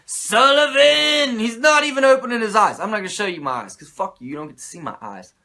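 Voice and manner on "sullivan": mock summoning call. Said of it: "he's like".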